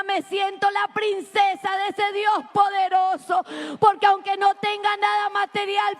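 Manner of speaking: high, emphatic pulpit declaration; exultant preaching. Real, not acted. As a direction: proclaim it with joy to the congregation. Preaches joy to congregation. (proclamación jubilosa desde el púlpito)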